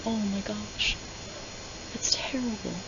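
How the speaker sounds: soft, almost whispered pity; hushed sympathy. Pretend. He gasps softly at her scars, hushed. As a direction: breathe it out in hushed dismay. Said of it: "unironically, not being sarcastic".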